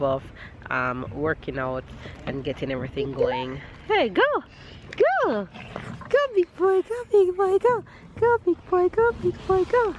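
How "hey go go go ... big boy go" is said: high, loud cheering. Real, not acted. Cheering toddler on slide.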